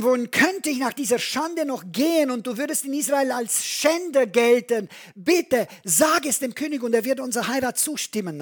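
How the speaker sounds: deutlich höher und lauter, flehend; verzweifeltes Flehen. Acted. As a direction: bitte um dein Leben. Tamar fleht Amnon an. (Tamar bittet den Bruder verzweifelt, höher und lauter)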